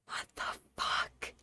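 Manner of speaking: whispered, slow disbelief; stunned whisper. Retold or imitated. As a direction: breathe it out in shock. Stunned muttered reaction.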